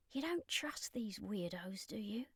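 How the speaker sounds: put-on child quietly asking the vampire, hushed and wary. A narrator's reading. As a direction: murmur it so the grown-ups can't hear. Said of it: "Ashley said quietly".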